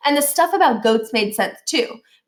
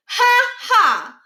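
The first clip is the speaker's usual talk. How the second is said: much higher, louder spoken ha ha; a sarcastic kid saying ha ha instead of laughing; 14 semitones higher, 5 dB louder than usual. A narrator's reading. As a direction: say the laugh as words, mocking. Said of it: "He actually said, "Ha ha".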